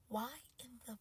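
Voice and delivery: whispered, broken-off question; hushed disbelief; stunned whisper. Acted. Breaks off in disbelief.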